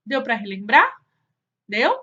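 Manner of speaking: ordinary talk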